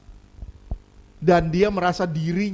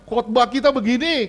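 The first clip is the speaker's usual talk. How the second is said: ordinary talk, nothing marked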